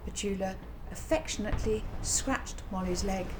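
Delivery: ordinary delivery, nothing marked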